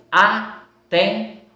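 "atendente" slowly as a, ten, den, te; split into syllables. Shown as a demonstration. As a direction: pause after each syllable of "atendente". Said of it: "Quatro sílabas".

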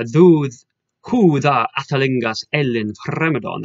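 put-on "atalingas ellen fremedon" declaimed in Old English pronunciation; Old English recitation. Shown as a demonstration. Showing students the following line of verse, Old English recitation. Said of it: "And then the following one".

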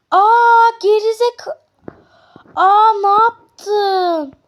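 high, loud, stretched-out outrage; outraged shout. Real, not acted. Shopkeeper yelling at a customer who threw food, outraged. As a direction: cry out in disbelief at the insult.

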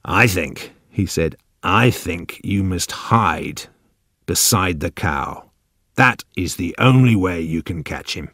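put-on slow, deliberate old man. A narrator's reading. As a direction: speak very slowly and a little low, weighing the words. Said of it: "The old man spoke very slowly".

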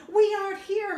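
squeaky high little cub; high tiny bear voice. Acted. Smallest snow bear teasing Mommy, high and cheeky.

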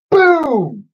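loud, high, drawn-out BOOM; sudden booming bang; explosive boom. A narrator's reading. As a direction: burst out like an explosion. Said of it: "There was a deafening explosion".

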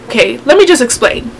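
ordinary talk

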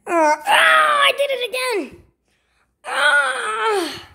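high wail of pain; acted pain. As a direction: cry out as if freshly hurt. Hurt again.

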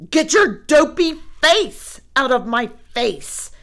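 snarling. A narrator's reading. Brother snarling at sister over bunk beds, angry snarl. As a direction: snarl it at her. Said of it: "snarled Brother".

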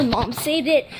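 normal talking voice

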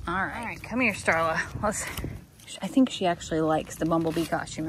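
normal talking voice

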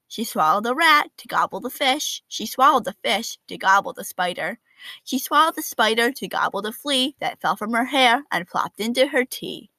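ordinary delivery

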